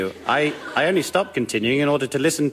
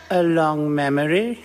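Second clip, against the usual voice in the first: normal talking voice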